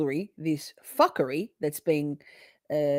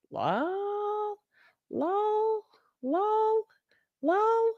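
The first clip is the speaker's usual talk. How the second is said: much higher squeaked lol; squeaky lol; much slower than usual. Real, not acted. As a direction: squeak it out in a giggly voice. Laughing squeals.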